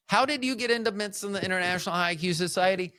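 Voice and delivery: high, fast imagined question; incredulous listener. Acted. Listeners asking about the society, high quick mock disbelief. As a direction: blurt it as an astonished audience member, pitched high. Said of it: "I know you're like".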